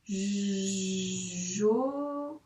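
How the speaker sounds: J held, then joli drawn out and rising; sounded out. Shown as a demonstration. Teacher sounding out joli while writing it. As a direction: spell it out as the pen moves. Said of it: "s'entraîner à écrire le mot joli".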